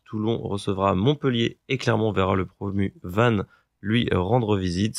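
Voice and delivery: usual speaking voice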